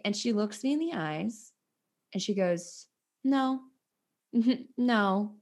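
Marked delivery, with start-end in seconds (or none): 3.2-5.3 mock condescending, lightly raised pitch. Ballet woman turning away the nanny, condescending. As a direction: imitate someone talking down, with a small laugh. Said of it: "like that to me".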